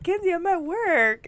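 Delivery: put-on high, loud, drawn-out explanation to a child; mock pleading aunt. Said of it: "I was like".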